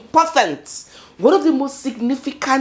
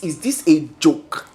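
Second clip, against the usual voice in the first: ordinary talk, nothing marked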